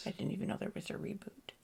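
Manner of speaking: whispered aside; real whisper. Real, not acted. Co-host whispering surprise about the cancelled reboot. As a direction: breathe it out as a secret.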